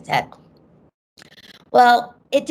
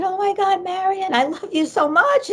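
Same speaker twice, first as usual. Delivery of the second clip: tearful outpouring; 10 semitones higher than usual. Retold or imitated. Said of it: "and I'm crying".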